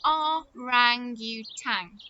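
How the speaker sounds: "Orangutan" slowly, each syllable separated; careful, slow. Shown as a demonstration. Teacher shows children a hard word, slow careful "Orangutan". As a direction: spell out "Orangutan" by ear, syllable by syllable. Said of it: "quite hard to say that one".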